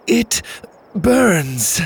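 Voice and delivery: high, agonized acted outcry; pained groan. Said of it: "Mora started to groan as well".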